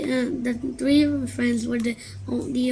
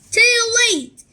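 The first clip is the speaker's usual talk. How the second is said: loud, higher gleeful crow; 11 semitones higher, 10 dB louder than usual. Acted. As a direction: gloat cheerfully. Rip gleeful.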